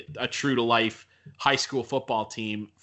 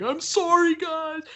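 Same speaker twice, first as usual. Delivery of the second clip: much higher, choked apology of a crying player. Retold or imitated. Retelling the losing player apologizing to teammates in tears, high choked voice. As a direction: voice the heartbroken player apologizing to his team. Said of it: "in the locker room crying".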